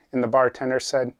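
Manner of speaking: ordinary talk, nothing marked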